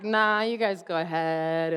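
low, quiet mock sulk; lightly acted opt-out from the sidelines; party pooper. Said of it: "like, the party pooper".

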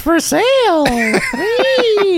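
put-on high cackling old witch. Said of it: "I'm stuck in witch voice".

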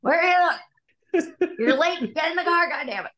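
put-on high, raspy dad hollering for the kids; mock a raspy, cursing dad rounding up children; dad's raspy voice. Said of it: "Doing my dad's raspy voice".

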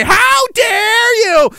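shrill hammed-up high yell, slow; mock their indignant shriek; drawn-out, high, loud mock cry; mock outrage. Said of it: "point fingers and say".

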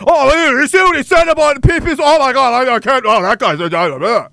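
high, loud whiny mock outrage; a hammed-up scandalized gasp of a hater; mocking outraged critic. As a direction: sneer at their overblown shock. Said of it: "they'll take any example".

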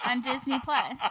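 usual speaking voice

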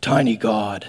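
low, gruff dismissive verdict; Hulk's huff. Acted. Hulk huffing at Loki after smashing him, dismissive. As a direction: drop it low like a huffing brute. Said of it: "he just, like, huffs and he's like".

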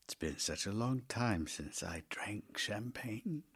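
faint dying whisper. Acted. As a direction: breathe it out, barely voiced. Dying man's last words.

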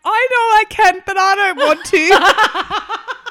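high, loud, slow whiny protest; petulant refusal. Retold or imitated. To the midwives urging her on, loud whiny refusal. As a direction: stretch it out like a stubborn child. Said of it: "I'm like".

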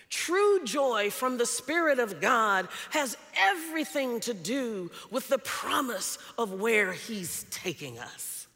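loud, rising sermon cadence; preaching at full force. Acted. Pastor exhorting the congregation, loud and rising. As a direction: build it like a pulpit appeal.

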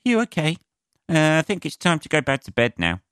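high, wary parent voice; uneasy parent. Acted. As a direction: humour a creepy child in a high, nervous voice. Parent waking to staring kid.

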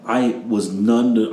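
ordinary delivery

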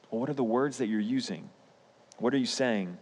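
usual speaking voice

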